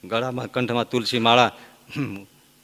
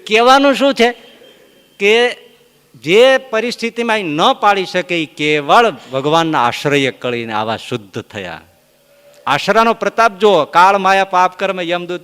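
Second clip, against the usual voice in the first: loud, high preaching of the moral; a preacher driving home the moral; 5 semitones higher, 8 dB louder than usual. Acted. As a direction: proclaim it to the assembly. Preaching the moral at full force.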